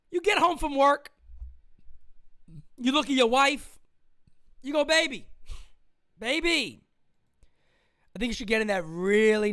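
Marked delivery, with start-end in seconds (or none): none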